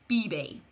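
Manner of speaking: "bibe" with b sounds, falling; model Spanish verb. Shown as a demonstration. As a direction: say "bibe" clearly for learners. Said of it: "Repeat after me".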